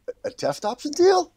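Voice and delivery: high-pitched rising question; incredulous echo. Retold or imitated. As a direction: repeat it in mock disbelief, voice shooting up. Incredulous at his not knowing.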